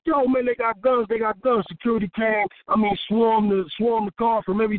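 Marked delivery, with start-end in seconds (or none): 0.1-1.7 panicked warning. Retold or imitated. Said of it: "he was like".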